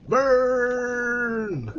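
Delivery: high loud villain yell of Burn!; angry villain cry. Retold or imitated. Streamer imitates the game's angry villain, high loud yell. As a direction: shout it like the furious bad guy. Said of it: "Quand le méchant était pas content".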